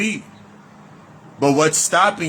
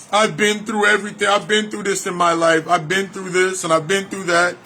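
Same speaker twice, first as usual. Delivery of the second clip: higher pitched mock whine of someone who's been through it all; mock victim voice; 3 semitones higher than usual. Mocking someone who claims trauma, in a mock whine. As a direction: play the self-pitying victim, a touch whiny.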